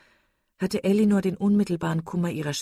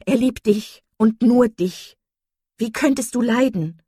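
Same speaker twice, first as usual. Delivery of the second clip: high, loud, vehement insistence; impetuous; 6 semitones higher, 8 dB louder than usual. A narrator's reading. Marian insists her sister cannot be suffering, impetuous. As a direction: press the point passionately, raising the voice. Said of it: "rief Marian ungestüm".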